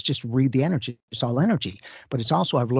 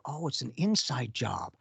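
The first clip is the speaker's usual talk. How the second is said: soft realization; 9 dB quieter, slower than usual. Retold or imitated. Quiet realization, quoted inner voice.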